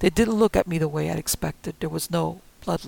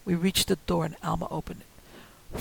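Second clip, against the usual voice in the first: usual speaking voice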